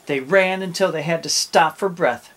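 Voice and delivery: usual speaking voice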